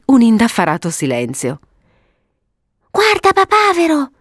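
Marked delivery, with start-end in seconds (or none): 2.9-4.1 put-on very high girlish cry; excited exclamation. Violetta suddenly calling her brother to look, excited. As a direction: burst out in childish excitement. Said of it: "esclamò a un tratto Violetta tutta eccitata".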